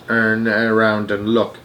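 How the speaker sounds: ordinary delivery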